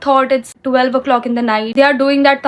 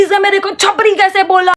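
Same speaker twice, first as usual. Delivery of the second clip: higher, whiny mimic of him; mocking the offended guy; 6 semitones higher than usual. Mocking the politician's son whining about being insulted. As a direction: mimic the offended brat indignantly. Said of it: "बोलता है कि".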